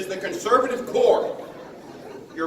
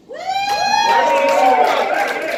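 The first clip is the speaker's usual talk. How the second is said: calling out; 6 dB louder, much slower than usual. Real, not acted. Calling out for an official.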